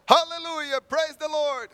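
very high, very loud hallelujah; startling scream of joy over a magazine; sudden praise scream. Retold or imitated. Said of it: "what's there for you to be screaming about".

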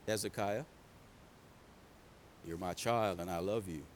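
very low, soft and loving; hushed fatherly tenderness; tender God voice. Acted. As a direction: speak gently, like a father to his child. God speaks tenderly to Hezekiah.

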